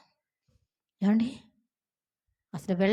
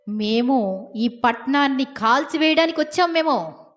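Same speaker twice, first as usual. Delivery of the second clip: slow, louder stern announcement; solemn proclamation; 4 semitones higher, 6 dB louder than usual. Retold or imitated. Angels telling Lot they came to burn the city, slow and louder. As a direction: proclaim judgement slowly.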